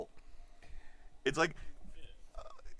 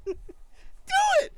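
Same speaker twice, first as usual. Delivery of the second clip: jealous petty voice; 6 dB louder than usual. Acted. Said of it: "that's my jealous, petty voice".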